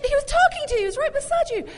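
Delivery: high, loud disbelieving wife; wife acting out husband ignoring son; exasperated wife. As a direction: scold him in mock disbelief. Wife mock-scolds distracted husband.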